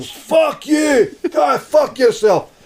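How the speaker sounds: high, loud mock shouting match; screaming row. Couple having a full-on row in the pub, screaming. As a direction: hurl drawn-out insults, imitating a raging couple. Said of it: "they're having a full-on bang of the two of them".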